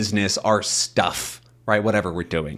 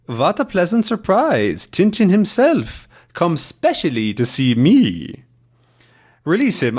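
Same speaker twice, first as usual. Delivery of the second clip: higher-pitched mock German villain; terrible German accent; 7 semitones higher, 3 dB louder than usual. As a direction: gloat in a hammed-up German accent. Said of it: "my terrible German accent".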